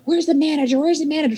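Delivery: high insistent where's the manager; entitled demand. Retold or imitated. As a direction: play the entitled customer.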